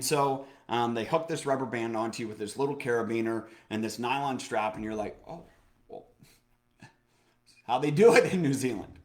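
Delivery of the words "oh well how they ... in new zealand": mock offhand. Mock quote of the bungee crew.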